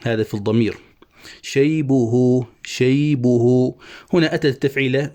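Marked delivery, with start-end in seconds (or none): 1.4-3.8 شيبه drawn out slowly with a final long u; slow scansion. Shown as a demonstration. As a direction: stretch شيبه into شيبهو to show the vowel lengthening. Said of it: "نشبع هذه الضمة واوا ساكنة". (نطق شيبه ببطء مع إشباع الهاء)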